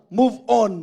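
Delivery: loud, punchy prompt; wake-up call. Shown as a demonstration. Preacher rousing sleepy congregation members, loud call. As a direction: say it loud enough to rouse a dozing listener. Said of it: "Para, para magising-gising pa".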